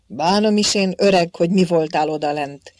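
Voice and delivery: loud, fast, irritated dismissal; fuming. A narrator's reading. Friar fuming at the old king, irritated. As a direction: grumble him off. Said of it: "mérgelődött a csuhás".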